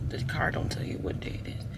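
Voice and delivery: unvoiced, muttered to herself; whispered aside. Real, not acted. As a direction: let it slip under your breath. Aside about GPS.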